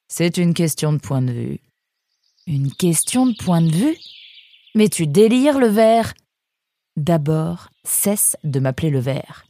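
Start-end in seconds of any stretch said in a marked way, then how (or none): 2.5-6.1 aigu et fort, provocateur; moquerie vexée. A narrator's reading. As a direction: lancer l'insulte, voix montée. Nemrod mocks. (Nemrod se moque de Mirko, voix aiguë)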